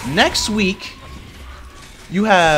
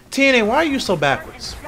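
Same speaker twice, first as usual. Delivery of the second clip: exasperated rant; 8 semitones higher than usual. Real, not acted. Ranting at the promotion.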